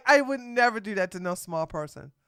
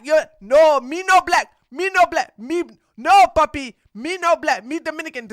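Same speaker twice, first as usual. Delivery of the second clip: louder and higher, hammed-up broken English; mock broken-English accent; 5 semitones higher, 7 dB louder than usual. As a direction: ham up a clumsy accent for a laugh. Mocking boyfriend's accent.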